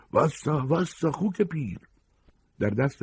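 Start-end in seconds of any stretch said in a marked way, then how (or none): 0.1-1.8 high-pitched, drawn-out yell; shouted threat. Acted. Girl yelling at a fleeing old man, shouted threat. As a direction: holler it high, stretching the words.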